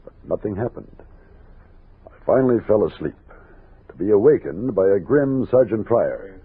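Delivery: low, measured storyteller voice over music; story narration. A narrator's reading. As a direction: tell it like a frontier memoir. Narrator recounts the night's events.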